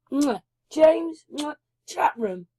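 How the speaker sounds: ordinary delivery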